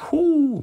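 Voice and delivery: a real shout of delight at the scripture; ecstatic whoop. Real, not acted. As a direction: let out a jubilant holler. Overjoyed after reading the verse.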